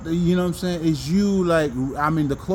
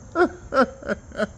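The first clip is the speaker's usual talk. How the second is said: high breathy burst of laughter; 8 semitones higher than usual. Real, not acted. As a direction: let a high laugh escape. Laughing fit.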